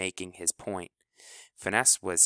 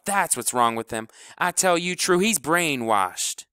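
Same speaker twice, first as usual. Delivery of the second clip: loud, high furious rant; 6 semitones higher, 5 dB louder than usual. Acted. Kleebo rants at the team about House, loud and furious. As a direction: rail at the whole team. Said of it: "Kleebo was a geyser".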